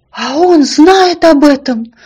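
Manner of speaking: high, drawn-out, alarmed question; horrified. A narrator's reading. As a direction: ask it aghast. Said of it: "спросил маленький ослик в ужасе".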